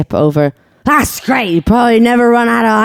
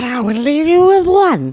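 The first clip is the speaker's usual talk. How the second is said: high, drawn-out imitation swooping upward; over-exaggerated movie quote; 7 semitones higher, slower than usual. Redoing Dad's favorite line, really over-exaggerated. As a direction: stretch the line out and ham it up. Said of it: "Really over-exaggerated".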